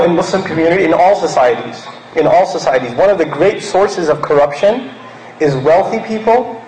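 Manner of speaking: usual speaking voice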